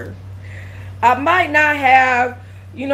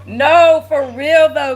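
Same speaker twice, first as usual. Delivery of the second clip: laughing through tears; 5 semitones higher, 5 dB louder, much slower than usual. Real, not acted. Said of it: "about to make me cry".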